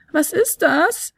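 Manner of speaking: slow, high, panicked question; frightened by a rusty screech. Acted. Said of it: "Die Panik in Fionellas Stimme".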